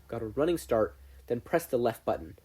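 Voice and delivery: plain reading voice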